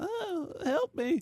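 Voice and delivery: tiny high pleading voice; fluttering cries for help. Retold or imitated. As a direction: squeak it like a trapped fly. Fly stuck on tape, voiced.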